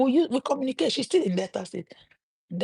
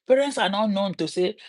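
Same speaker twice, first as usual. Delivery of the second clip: ordinary talk, nothing marked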